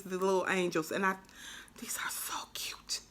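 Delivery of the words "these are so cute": whispered delight. Real, not acted. Crafter admiring tiny angel charms, whispered. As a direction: whisper it, too cute to say aloud.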